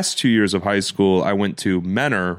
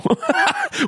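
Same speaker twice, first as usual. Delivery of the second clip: high laugh. Real, not acted. Laughing at own story.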